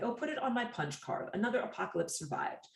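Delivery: wry, starting high and settling down; wry attitude. Acted. Survivor of many predicted apocalypses jokes, wry and unimpressed. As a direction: shrug off doomsday with dry humour. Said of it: "a rather wry attitude".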